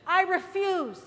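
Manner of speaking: slow, loud I refuse; defiant refusal. Retold or imitated. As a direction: shout your refusal, stretched out. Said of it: "what I kept saying in my car".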